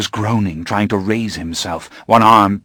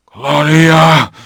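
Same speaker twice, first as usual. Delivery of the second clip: gasped; 7 semitones higher, 7 dB louder than usual. Acted. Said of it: "he gasped again".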